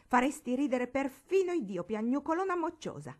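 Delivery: mock her crying with fury; furious taunt.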